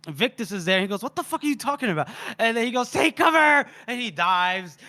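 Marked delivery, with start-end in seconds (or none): 1.0-2.1 quick, high-pitched incredulous question; acted bafflement. Bystander questions the screaming runner, fast mock bafflement. 2.9-3.7 loud, high urgent call; acted warning shout. Fleeing man warns his comrade, high mock-urgent shout.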